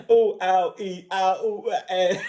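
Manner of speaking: high, staccato comic yelps; robot's mock ouches. Reviewer imitating the blasted robot, comic yelps of pain. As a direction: yelp each little pain sound separately.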